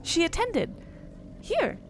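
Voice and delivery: high, stunned gasp of a line; earnest shock. Acted. The character learns her grandmother studied there, shocked. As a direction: say it in sudden wide-eyed shock. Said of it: "earnest, like, shock, she's just like".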